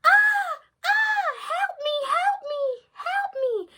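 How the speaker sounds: high, loud panicked screams; screaming for help. Acted. The girl attacked by the wolf, high loud screaming for help. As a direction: shriek for help. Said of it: "she started screaming".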